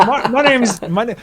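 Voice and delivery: put-on high-pitched stab at a Scottish accent. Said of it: "try the Scottish accent".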